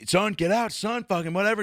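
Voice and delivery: high-pitched mock cop commands; aggressive cop. Cop ordering the kid out, aggressive. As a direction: imitate a worked-up cop giving orders. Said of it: "and then just goes".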